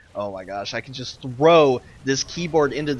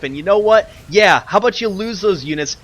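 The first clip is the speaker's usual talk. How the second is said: loud, higher, spiteful yell; raging outburst; 5 semitones higher, 9 dB louder than usual. Real, not acted. Caster raging at a player for letting the enemy rebuild, loud gloating. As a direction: gloat furiously at the losing player. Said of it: "I am super raging right now".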